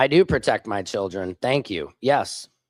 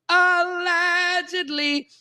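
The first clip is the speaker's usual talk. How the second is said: much higher, slow mocking allegedly; sarcastic aside; much slower than usual. Real, not acted. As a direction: sing out allegedly with heavy irony. Sarcastic aside about Grindr proof.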